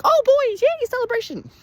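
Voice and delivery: very high, squeaky celebration; hammed-up fake glee; mock happy cheer. Reviewer mocks happy music after fake death, squeaky cheer. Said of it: "Cue the happy music".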